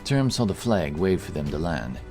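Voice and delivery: ordinary delivery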